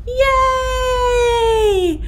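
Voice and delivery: long, high, loud cheer; big cheer. Real, not acted. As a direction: cheer with the word stretched right out. Cheer after the greeting song.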